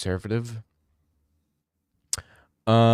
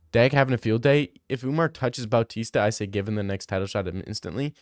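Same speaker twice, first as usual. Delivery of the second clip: ordinary talk, nothing marked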